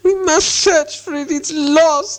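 slow, high creature wail; Gollum voice. A narrator's reading. Gollum must search for what is lost, wailing.

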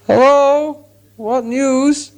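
high, slow, trembling call from afar; timid hobbit calling to a stranger, shaky. A narrator's reading. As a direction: call out nervously, voice wobbling. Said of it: "he called in a shaky voice".

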